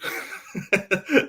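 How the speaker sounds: high bright laugh bursting out; burst of laughter. Real, not acted. Laughing at Pogba comparison.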